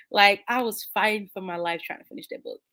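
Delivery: usual speaking voice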